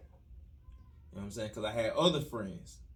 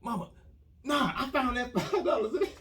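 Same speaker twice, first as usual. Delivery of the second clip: put-on pitched way up, half laughing, a kid selling a lie; squeaky boy's desperate fib; high kid's lie; 9 semitones higher than usual. Boy lying to his mom about the money, pitched high and pleading. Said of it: "try to say I found it in the dumpster".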